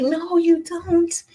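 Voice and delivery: high, slow, drawn-out brush-off; mock refusal. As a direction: turn them down in a high sing-song drawl. Her acted reply to that request.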